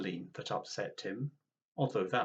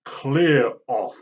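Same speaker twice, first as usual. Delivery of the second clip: loud angry dismissal; angry grannies; 13 dB louder than usual. Acted. Other grannies chasing granny away, loud and angry. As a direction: snap it, fists raised. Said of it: "shaking their fists angrily".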